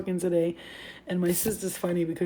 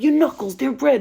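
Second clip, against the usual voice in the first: high, loud retold accusation; accusing; 6 semitones higher, 8 dB louder than usual. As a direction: call out the evidence, louder and higher. Retold confrontation with lying sister.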